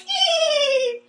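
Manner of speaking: very high-pitched mock squeal; imitated girls' giggle. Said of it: "all the girls are like".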